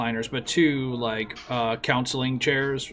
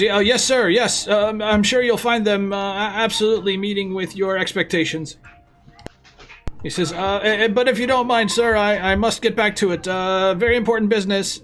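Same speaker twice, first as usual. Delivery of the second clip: nervous subordinate; 7 semitones higher, 4 dB louder than usual. Acted.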